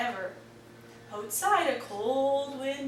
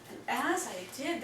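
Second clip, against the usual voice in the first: ordinary delivery, nothing marked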